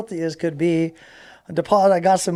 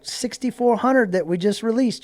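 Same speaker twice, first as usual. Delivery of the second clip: lightly voiced woman, pitched up; imitated phone lady; 7 semitones higher than usual. Lady telling a caller more checks are coming, imitated higher. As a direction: play her delivering more news, in a higher voice.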